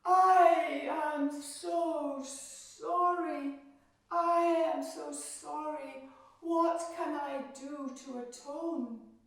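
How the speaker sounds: high, loud, slow begging; pleading apology. Acted. Queen begging Demeter, a high, loud, slow apology. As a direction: beg forgiveness with all she has. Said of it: "she said".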